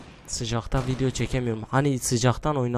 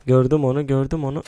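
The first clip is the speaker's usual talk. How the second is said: loud urgent callout; shouting a callout to teammates; excited shout; 8 dB louder than usual. Real, not acted. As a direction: yell it out as you spot the enemy. Spotting enemy in game.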